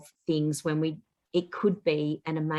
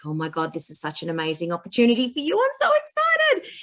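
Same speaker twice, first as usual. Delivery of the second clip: fast, rising into a high squeal; excited; 5 semitones higher, faster than usual. Retold or imitated. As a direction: burst out with delight at the news. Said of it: "And I was like".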